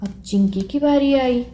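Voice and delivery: plain reading voice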